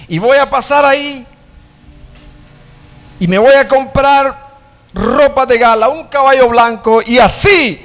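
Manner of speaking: high and loud, building up; excited big plans. Acted. Singer plans his grand entrance, high and loud. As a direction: dream big, rising and loud.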